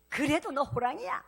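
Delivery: mother repeats it firmer, "그래도" rising. Retold or imitated. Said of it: "엄마, 나 누구야?".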